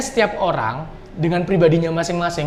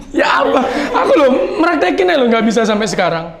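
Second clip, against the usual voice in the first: laughing, high and loud at first; embarrassed laughing; 5 semitones higher, 3 dB louder than usual. Real, not acted. Unable to act out the request to a model, laughing in embarrassment. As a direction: laugh helplessly at your own failed attempt.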